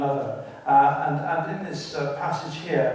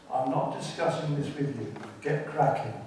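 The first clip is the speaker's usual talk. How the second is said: usual speaking voice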